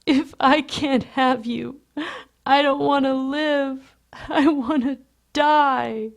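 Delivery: high, loud, drawn-out tearful wail; blubbering. A narrator's reading. Lovesick Lewis despairs to Patrick, blubbering. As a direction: blubber it slowly in heartbroken despair. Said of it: "Then he blubbers".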